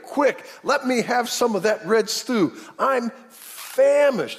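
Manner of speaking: high, drawn-out hungry plea; acted famished urgency. Hungry hunter begging his brother for stew, famished urgency. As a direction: stretch it out high as a hammed-up starving man.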